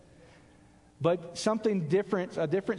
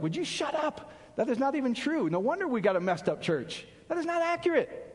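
raised, exasperated imitated snapping back at a teacher; mock angry retort; 6 semitones higher than usual. Pastor mocking a Bible teacher, exasperated. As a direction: scoff at the false teaching, higher. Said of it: "I get angry at that".

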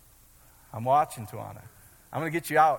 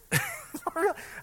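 high laugh. Real, not acted. Preacher laughs at own story.

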